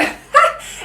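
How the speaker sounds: sudden high breathy laugh. Real, not acted. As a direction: burst out laughing at herself. Rit de sa santé fragile. (éclat de rire aigu et soufflé)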